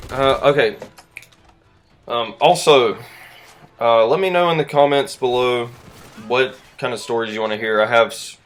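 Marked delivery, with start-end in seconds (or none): none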